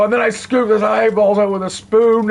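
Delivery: high, cartoonish villain voice, gleeful; Handsome Jack. Quoting the villain's eyeball line to viewers, high and gleeful. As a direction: play it as a cheerful psychopath. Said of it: "It's like".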